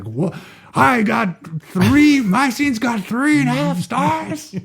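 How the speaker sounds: high, slow, drawn-out actor's outrage; Al Pacino. Acted. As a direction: stretch it out in hammed-up indignant disbelief. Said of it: "Al Pacino's like".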